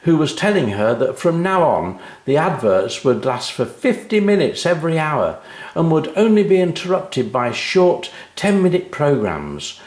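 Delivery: usual speaking voice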